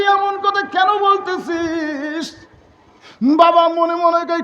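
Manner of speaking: high, slow mocking cry; mock shout. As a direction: draw it out sarcastically. Mocking son's false concern. (ছেলের মিথ্যা দরদকে ব্যঙ্গ করে টেনে চেঁচানো)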